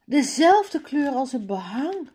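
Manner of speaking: calling out excitedly. A narrator's reading. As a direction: call out delighted about the matching colour. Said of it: "roept Mala".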